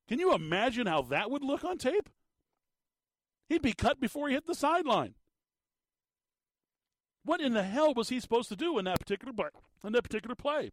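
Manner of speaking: fired-up radio host ranting at a penalty call; worked up. Real, not acted. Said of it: "I'm getting all worked up here".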